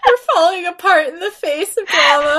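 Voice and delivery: laughing near tears; wheezing with laughter; laugh-crying. Real, not acted.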